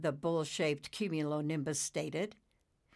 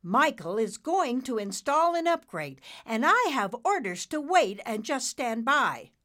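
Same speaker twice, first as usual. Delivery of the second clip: higher, louder, firm insistence; with conviction; 8 semitones higher, 7 dB louder than usual. Acted. Nimbus defending his orders to Dad, with conviction. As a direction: state it as settled fact. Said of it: "then added with conviction".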